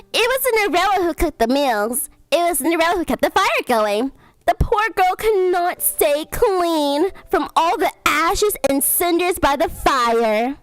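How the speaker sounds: lively storybook reading; story reading. Acted. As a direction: read like a bedtime story. Reads story aloud.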